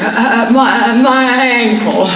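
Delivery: dumbfounded, halting stammer; stuttering. A narrator's reading. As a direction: stammer it out, stunned. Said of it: "She became dumbfounded and stuttered".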